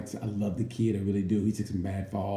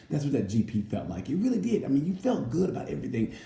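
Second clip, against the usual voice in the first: ordinary talk, nothing marked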